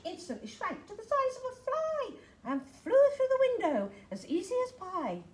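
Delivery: high and loud, like a shrinking bubble; high squeaky voice. Acted.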